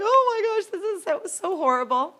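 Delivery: high, loud distressed wail; tearful outcry. Retold or imitated. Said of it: "then I'm crying".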